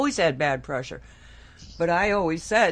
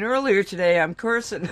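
ordinary talk, nothing marked

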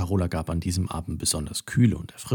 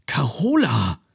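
higher call of the name, falling; mild shocked call; 5 semitones higher, slower than usual. A narrator's reading. Mathilda finds her cow in the pool, a dismayed call. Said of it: "rief sie entsetzt".

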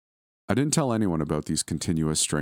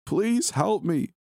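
very high, slow, agonized begging; wretched, pained plea; much slower than usual. Acted. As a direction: stretch the plea out in agony. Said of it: "His cries were wretched and pained".

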